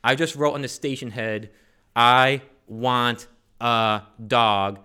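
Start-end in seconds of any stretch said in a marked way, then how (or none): 1.9-4.8 each word stretched out with force; slow, emphatic reading. Retold or imitated. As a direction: read the note out slowly and loudly, word by word. Reading out his letter to Santa.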